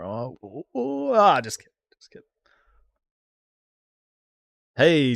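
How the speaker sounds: normal talking voice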